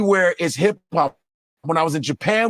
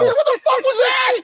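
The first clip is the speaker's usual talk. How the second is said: shocked shout. Real, not acted. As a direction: yell in total disbelief. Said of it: "shocked outburst".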